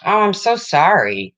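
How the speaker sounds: a quick burst of sympathy for a viewer's loss; sympathetic exclamation. Real, not acted. Condolence to a viewer.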